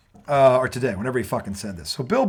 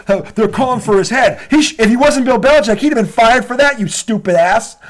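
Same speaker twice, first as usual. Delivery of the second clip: higher and louder furious tirade; angry rant; 8 semitones higher, 5 dB louder than usual. Real, not acted. Host rants at Bill Burr over Belichick, higher and louder. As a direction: tear into him, voice rising.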